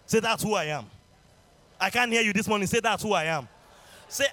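loud fervent call and response; preaching. Acted. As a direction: drive the congregation to shout. Preaching at full force.

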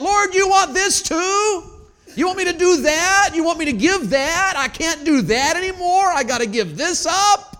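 high, shouted protest to the Lord; loud, acted-out bargaining with heaven; exasperated plea. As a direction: cry out to God, drawing words out.

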